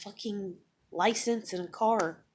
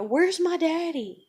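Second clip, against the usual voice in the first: put-on higher, louder, drawn-out child's plea; retold panicked cry; 8 semitones higher, 5 dB louder, slower than usual. Mother retelling daughter waking in panic, imitated cry. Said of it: "she's screaming and crying in a panic".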